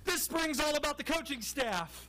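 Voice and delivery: a hammed-up coach scrambling for talking points; mocking a flustered coach. Host imitating a coach who lost his script, mock panic. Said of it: "He caught himself in the middle of the answer and said".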